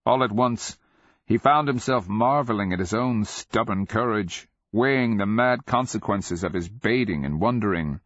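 usual speaking voice

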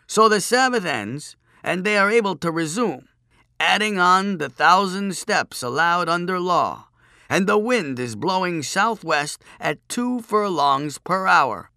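high, loud, pompous recital; droning chief priest. A narrator's reading. Chief priest adding conditions to his puzzle, droning on. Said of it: "the chief priest droned on".

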